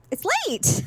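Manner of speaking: incredulous protest. Retold or imitated. Her protesting to him.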